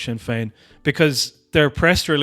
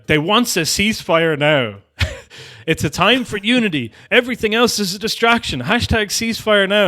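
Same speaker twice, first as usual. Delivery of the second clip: aggro tweet; 7 semitones higher, 3 dB louder than usual. Retold or imitated. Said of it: "getting aggro about it".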